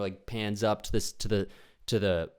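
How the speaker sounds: normal talking voice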